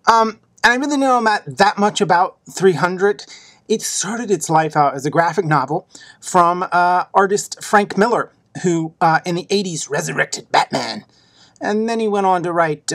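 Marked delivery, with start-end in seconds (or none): none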